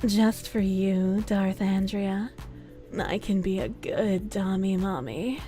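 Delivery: slow, soft teasing mommy voice; dummy mommy voice. Pretend. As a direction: drag it out, coy and sultry. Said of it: "I feel like I'm obligated now".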